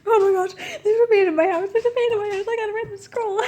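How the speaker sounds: high, fast, loud hammed-up panic; mock panic. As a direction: ham up the scientist's panic. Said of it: "He's literally just like".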